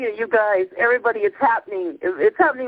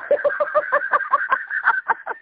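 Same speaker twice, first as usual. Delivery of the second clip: breathless laugh. Real, not acted. Breathless laugh after revelation.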